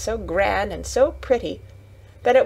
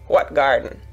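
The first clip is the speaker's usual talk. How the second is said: lower, curt grunt; surly grunt; 6 semitones lower than usual. A narrator's reading. Gardener turning surly at Mary's question, low grunt. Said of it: "grunted Weatherstaff, becoming surly".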